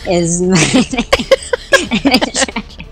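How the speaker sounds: giggling through the words; laughing. Real, not acted. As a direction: break up laughing mid-line. Repeats quote laughing.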